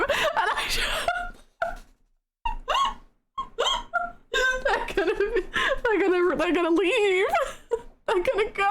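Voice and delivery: high, tearful laughter; words squeezed out between giggles; laughing through words. Real, not acted. Laughing to chat about Irish moderators leaving.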